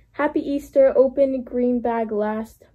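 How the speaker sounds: ordinary talk, nothing marked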